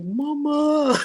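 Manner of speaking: put-on high, loud childlike wail; little girl crying. Grown woman retelling running to her mom, high childlike wail. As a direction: cry out for her like a small girl. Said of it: "I felt like a little girl".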